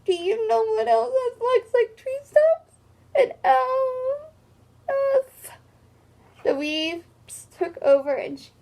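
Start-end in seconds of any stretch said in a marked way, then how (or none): 0.0-5.6 sobbing, much higher pitched; crying wail. Acted. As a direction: blubber through the question. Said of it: "wailed Fredgedy".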